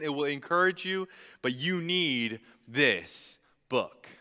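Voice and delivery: loud, high, urgent charge, slowing on key lines; impassioned plea. Retold or imitated. Paul charging young Timothy, loud impassioned plea. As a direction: plead like a dying mentor. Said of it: "saying to this young pastor".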